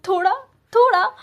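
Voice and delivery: sudden loud high snap; angry outburst. A narrator's reading. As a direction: spit the word in rage. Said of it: "गुस्से में ... घूरते हुए कहता है". (गुस्से में तीखा झटका)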